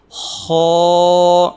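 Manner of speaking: خا said heavy, with a full mouth; full-mouthed kha. Shown as a demonstration. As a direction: fill the mouth on خا. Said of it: "جن حروف کو موٹا پڑھا جاتا ہے". (منہ بھر کے خا)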